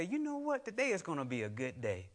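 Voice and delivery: bright, lifted voice with high starts; cheerful determined self-talk; upbeat believer. Retold or imitated. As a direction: sing out a hopeful morning vow. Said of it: "and say".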